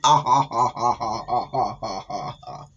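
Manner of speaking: cackling ha-ha-ha; maniacal laugh. Acted. Monster girlfriend cackling over her kill. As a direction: cackle like a mad thing. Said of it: "started laughing again like a freaking idiot".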